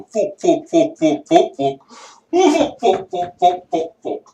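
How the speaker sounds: rapid, rising mock swear string; mock swearing rant. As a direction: rattle off the swears, mocking. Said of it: "I could sit there and go".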